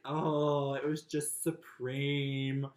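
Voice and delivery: very slow, luxuriating mock grandeur; mock over-the-top. Brother describing his trip in great aunt's words, mock-grand. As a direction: draw the last word out grandly. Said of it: "use the words deluxe and supreme".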